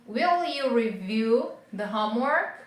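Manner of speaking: slow question, lifting at the end on "homework"; rising intonation. Shown as a demonstration. Teacher modelling a yes-no question for learners, rising intonation. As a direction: stretch "Will you review the homework?" and finish with a rise. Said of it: "a gente faz na entonação também".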